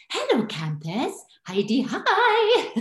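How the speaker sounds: high, slow put-on TV voice; TV impression. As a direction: stretch it out in a high character voice. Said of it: "record the people that I wanted to do impressions of".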